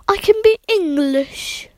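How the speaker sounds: badly faked English accent; attempted English accent. Shown as a demonstration. Ten-year-old showing listeners accents, badly faked English accent. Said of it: "That didn't sound anything like it".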